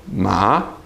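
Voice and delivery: MA rising from low; second tone. Shown as a demonstration. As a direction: lift MA upward like surprise. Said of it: "Second tone".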